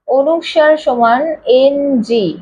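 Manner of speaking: এনজি said slowly as ng; slow explanation. Shown as a demonstration. Teacher explaining the nasal sound, slowly. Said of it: "অনুস্বারের উচ্চারণটা".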